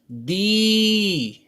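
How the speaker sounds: दी with the ई held long; teacher models a long दी. Shown as a demonstration. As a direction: draw out the vowel in दी. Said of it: "बड़ी ई को देर तक बोलते हैं ना".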